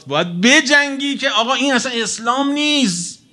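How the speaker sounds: high-pitched mock reproach; imitating a preachy critic; zealous scolding. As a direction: scold like a self-righteous zealot.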